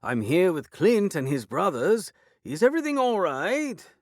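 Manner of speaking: high, loud calling through door; calling lord. A narrator's reading. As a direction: call through the door, concerned.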